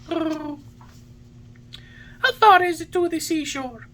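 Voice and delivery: very high puppet voice, mildly anxious. A narrator's reading. Pinocchio asks the pigeon the way to the seashore, anxiously. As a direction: ask it high, with some worry. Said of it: "asked Pinocchio anxiously".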